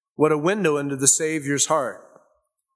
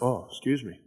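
softer, sheepish apology; embarrassed; 5 dB quieter than usual. Acted. Parade watcher caught screaming at a friend, mock embarrassed. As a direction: back off quietly, playing embarrassed. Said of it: "like, kind of embarrassed".

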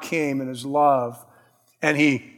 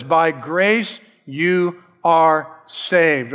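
each word laid down slowly; preacher stressing grace; slow emphatic quote; much slower than usual. Acted. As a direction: read the verse slow and weighty. Preacher quoting scripture slowly.

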